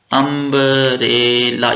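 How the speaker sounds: Umbrella drawn out syllable by syllable; slow careful pronunciation. Shown as a demonstration. Teacher sounding out umbrella slowly for students. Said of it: "pronounce करें आप".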